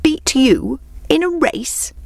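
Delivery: high, slow disbelief; incredulous exclamation. A narrator's reading. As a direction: exclaim it high and drawn out, as if absurd. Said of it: "exclaimed the hare".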